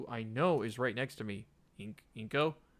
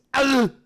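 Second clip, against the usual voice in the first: shriek; 11 semitones higher, 13 dB louder than usual. Acted. Scream at the figure.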